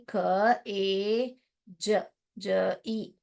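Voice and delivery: very slow sounding out of ख, ए, ज ई; spelling out. Shown as a demonstration. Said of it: "मैं क्या लिखूंगी?".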